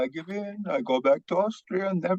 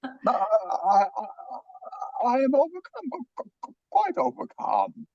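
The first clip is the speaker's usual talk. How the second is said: stammering overcome; 6 semitones higher than usual. Acted. Doctor stammering, overwhelmed.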